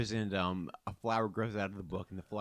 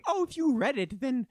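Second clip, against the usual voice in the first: high sweet princess voice; sugary fairy princess, sing-song; theme park princess; 12 semitones higher, 5 dB louder than usual. Acted. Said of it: "Says the Flower Princess".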